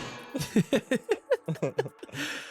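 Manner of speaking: long, rolling chuckle; drawn-out amused laugh; laughter. Real, not acted. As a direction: laugh at the harsh verdict. Laughs at the harsh comment.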